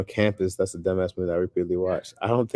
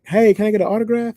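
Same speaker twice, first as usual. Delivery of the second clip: higher, louder bright fan voice, lightly imitated; eager fan girl; 14 semitones higher, 8 dB louder, faster than usual. Girl asks the comedian for an autograph, imitated eager fan. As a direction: play the excited fan asking for a signature.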